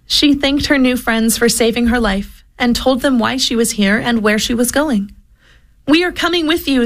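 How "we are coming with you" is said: higher happy cheer. A narrator's reading. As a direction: cheer it out together. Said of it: "the animals cheered".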